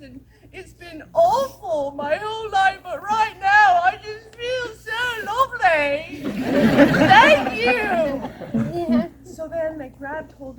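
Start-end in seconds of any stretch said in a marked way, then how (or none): 0.0-9.1 mock-crying, higher and louder; transformed witch thanking the kids, half-sobbing; tearful. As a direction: gush through hammed-up tears.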